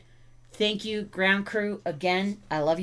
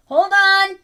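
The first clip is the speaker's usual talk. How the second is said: high, loud call to wait; 13 semitones higher, 11 dB louder than usual. Real, not acted. Owner puts off dog who wants out, calling out. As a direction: holler it across to the dog. Calls to dog ringing bells.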